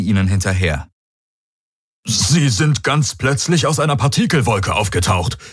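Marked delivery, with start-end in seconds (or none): 2.1-5.4 Hawks reporting. A narrator's reading. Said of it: "berichtete Hawks".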